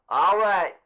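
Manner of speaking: loud official announcement; calling out. Acted. Mister Summers to the crowd, loud and official. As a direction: call it across the square.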